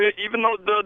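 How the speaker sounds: ordinary delivery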